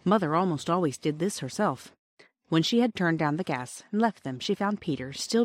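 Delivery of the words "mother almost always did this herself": ordinary delivery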